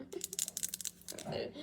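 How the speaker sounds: slow whisper through a laugh; giggly whisper. Real, not acted. Vlogger giggling her excitement in a whisper.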